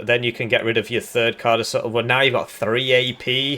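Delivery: normal talking voice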